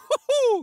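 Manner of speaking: short, very high burst of laughter; one quick gleeful laugh; sharp laugh. Acted. Agent gloating over a downed enemy, quick high laugh.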